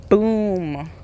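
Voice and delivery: ordinary talk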